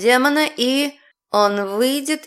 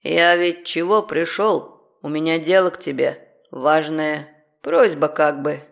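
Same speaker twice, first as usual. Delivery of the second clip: demon voice; 6 semitones lower than usual. Acted. Demon asking a favour.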